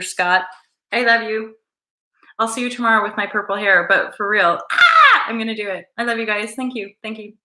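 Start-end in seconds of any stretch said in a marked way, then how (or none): 4.7-5.2 short, loud high squeal; sudden high-pitched shriek; high scream. Real, not acted. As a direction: let out a sharp squeal. Yelps about dyeing hair purple.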